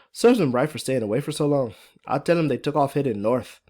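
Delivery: strong Irish lilt, playing the woman; thick Irish accent. Fiona plots against her husband, thick Irish accent. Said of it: "Irish accent is pretty thick and strong".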